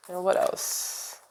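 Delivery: breathy whisper, slow; whispered aside. Real, not acted. Reader murmurs to herself while drawing the next card, whispered. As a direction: murmur it to yourself. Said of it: "whispered aside to cards".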